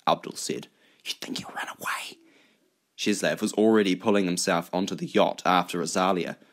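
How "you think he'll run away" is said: whispered question; hushed, secretive. Acted. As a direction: ask it in a very soft whisper. Secret argument in the boat.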